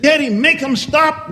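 put-on high, pleading cry of a little boy; child pleading. Little boy begging his daddy during the play, high pleading cry. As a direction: beg daddy to make it stop. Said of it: "Timmy jumped in his daddy's lap and said".

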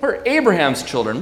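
loud, high indignant protest; proud crowd voice. Acted. Preacher voicing the crowd, high and loud. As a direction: play the offended crowd shouting back.

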